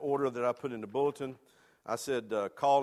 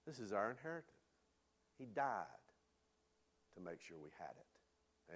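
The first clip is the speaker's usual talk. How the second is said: soft reverent; 5 semitones lower, 13 dB quieter than usual. Real, not acted. Solemn statement about Jesus' death.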